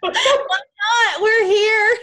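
high laughing shout; laughing, coaxing shout. Real, not acted. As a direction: urge her on through laughter. Host urging with a laugh.

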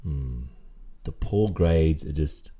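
ordinary talk, nothing marked

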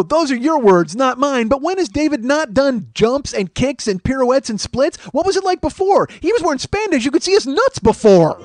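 high, heated, incredulous rant; mad chewing-out. Retold or imitated. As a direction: lay into him, building to a loud yell. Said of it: "I was just so fucking mad".

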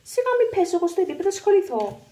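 high, loud, fast scorn; scornful. Acted. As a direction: toss it off with disdain. Scornful dismissal of ex-wife.

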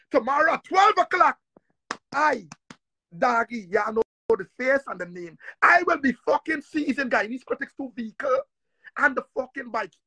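ordinary talk

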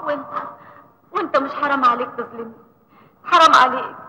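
high, tearful, rising pleas; tearful pleading. Acted. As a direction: plead through tears. Sanaa pleads, crying.

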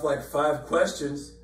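ordinary talk

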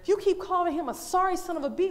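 ordinary talk

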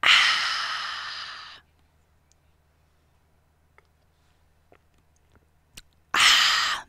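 unvoiced breathy ah, very soft; a mock ASMR sigh into the mic; ASMR breath. Shown as a demonstration. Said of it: "Did you like that A S M R".

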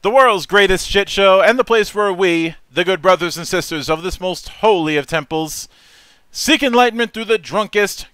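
loud, high-pitched hype-man announcer; show announcer intro. Acted. Host welcoming listeners and introducing the guest, booming announcer delivery.

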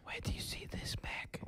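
breathy whisper right in the ear. Acted. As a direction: whisper it close and secret. Whisper song bit, unvoiced.

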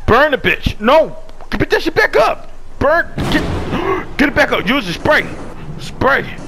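high, loud frantic orders; panicked yelling. Real, not acted. Gamer shouting orders at his teammate mid-chase, panicked. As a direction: bark panicked commands.

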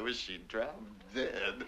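a slow, hushed dig; under-the-breath aside. Retold or imitated. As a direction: say it to yourself behind her back. Muttered aside about the mistress.

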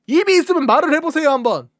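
loud fast demand to speak; mock angry demand. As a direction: snap at the defendant. Prosecutor demanding an answer.

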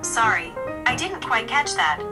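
usual speaking voice

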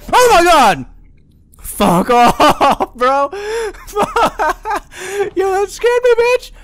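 very high, loud scared scream with laughter; jump-scare shriek. Real, not acted. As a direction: shriek at the sudden fright. Jump scare in horror game.

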